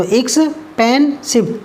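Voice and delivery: Expensive slowly split x, पेन, सिव; three syllables. Shown as a demonstration. Teacher counting syllables, expensive in three slow beats. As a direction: pause between all three parts of expensive. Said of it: "तीन syllable का है".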